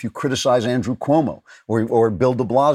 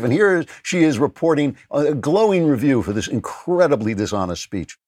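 usual speaking voice

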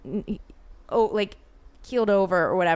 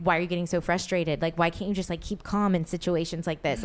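usual speaking voice

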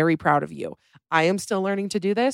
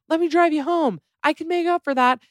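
much higher, louder mock creep calling after her; creepy man calling out; 11 semitones higher, 4 dB louder than usual. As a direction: call out like a sleazy guy in a high mocking voice. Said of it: "He's calling out things like".

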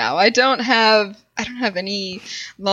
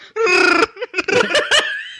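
laughing; 8 semitones higher than usual. Real, not acted. Laughing at joke.

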